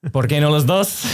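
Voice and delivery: meme quote. Pretend.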